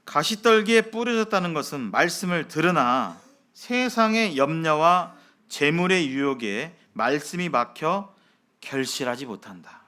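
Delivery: ordinary talk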